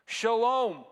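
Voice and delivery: loud, high proclamation; emphatic. Acted. Jesus greeting disciples in the locked room, emphatic. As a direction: call it out across the room. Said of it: "speaks that emphatic message".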